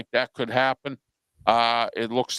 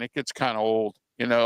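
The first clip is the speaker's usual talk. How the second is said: ordinary delivery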